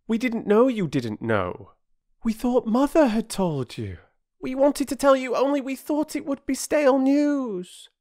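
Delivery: put-on high-pitched children protesting; anxious young excuse; apologetic children. A narrator's reading. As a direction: explain yourself in an eager, rising child voice. Voices children explaining at once.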